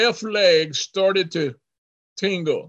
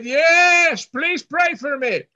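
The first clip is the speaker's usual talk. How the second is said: high, drawn-out 'yes', then a called plea; calling back from afar; 9 semitones higher, slower than usual. Retold or imitated. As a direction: answer from the back room in a raised voice. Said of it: "in the back he said".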